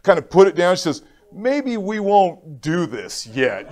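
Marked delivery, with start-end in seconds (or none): 1.3-3.7 gentle nurse. Retold or imitated. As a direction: ease off very slowly, like sparing him.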